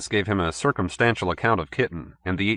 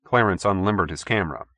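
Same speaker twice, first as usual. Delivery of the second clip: ordinary delivery, nothing marked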